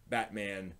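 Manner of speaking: ordinary talk, nothing marked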